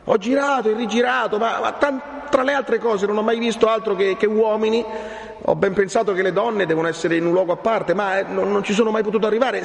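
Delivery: put-on high-pitched, breathless-to-explain young Renzo; agitated young man. Renzo tells the friar of his vain search, agitated. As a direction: pour it out high and eager.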